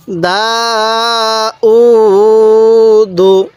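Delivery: দা and উ held long, দু short; one-alif elongation. Shown as a demonstration. Teacher showing how to read it, drawn-out দা উ, short দু. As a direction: stretch দা, stretch উ, then close briefly on দু. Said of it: "কীভাবে পড়ব?".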